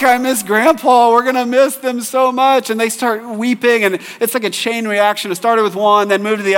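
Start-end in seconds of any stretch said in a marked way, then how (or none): 0.0-2.7 imitating crying child. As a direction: echo a weepy kid leaving grandma's house. Kids weeping leaving grandparents.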